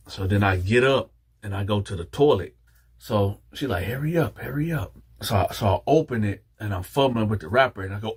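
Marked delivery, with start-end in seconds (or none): 3.8-5.0 impatient urging. Retold or imitated. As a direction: rush him along under your breath. She rushes him in the story.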